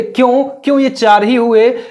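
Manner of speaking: normal talking voice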